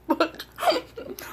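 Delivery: high, slow laughing disbelief. Real, not acted. Laughs at her own cheese mix-up.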